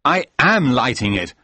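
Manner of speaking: angry retort. A narrator's reading. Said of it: "I said angrily".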